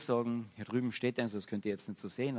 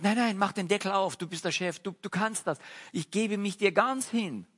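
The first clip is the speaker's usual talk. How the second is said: much higher, louder eager surrender; eager grand piano; 9 semitones higher, 6 dB louder than usual. Acted. Grand piano begs the master to open its lid, high and loud. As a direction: throw yourself open to the master.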